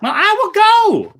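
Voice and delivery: high, loud eager offer; eager cry. A narrator's reading. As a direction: leap up and volunteer with a cry. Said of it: "cried the eldest girl".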